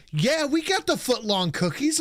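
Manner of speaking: a light high imitation of the cashier. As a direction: mimic the chirpy worker behind the counter. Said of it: "And she goes".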